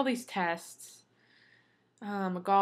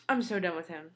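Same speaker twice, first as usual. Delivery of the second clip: ordinary delivery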